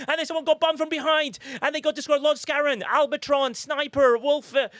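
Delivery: excited commentary. Real, not acted. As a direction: call the battle at full excitement. Climax of marble race battle.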